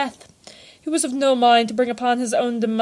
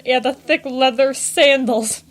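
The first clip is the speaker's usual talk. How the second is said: plain reading voice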